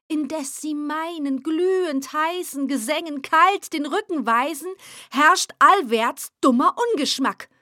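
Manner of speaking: loud, raging rant against the public; furious poet. Acted. Frustrated poet ranting at his empty room, loud and bitter. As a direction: rail at the world, fuming.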